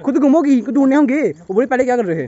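loud, high-pitched scolding; indignant outburst. Real, not acted. Vlogger blaming a companion who got lost, indignant.

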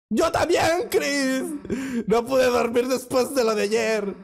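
high, eager burst of joy; excited. Acted. Dubbing a friend who greets Chris, high and excited. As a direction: leap up in pitch, thrilled.

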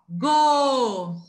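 "Go" loud, with a long held O; teacher's emphatic, sustained "Go"; syllable demo. Shown as a demonstration. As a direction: stretch out "Go" to show the O. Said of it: "quando eu falo go".

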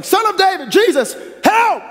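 high, straining cry for help; a blind beggar yelling over the crowd; desperate shout. Retold or imitated. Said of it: "So I can just imagine him shouting".

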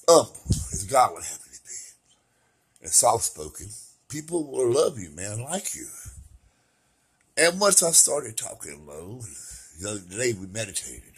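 ordinary delivery

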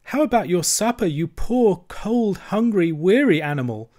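high-pitched forlorn lament; moaning. Acted. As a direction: pity your hungry friend. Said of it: "he moaned".

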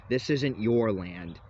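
ordinary delivery

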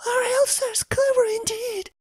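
high, tearful marvelling; tearful mother. Acted. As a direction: say it as tears start. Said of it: "she wept with the rest".

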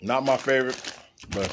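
talking with food in mouth; words through closed chewing lips; chewing while talking. Real, not acted. Seen 1: Ate chip at -5; lips pursed, chewing through the words.

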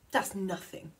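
ordinary delivery

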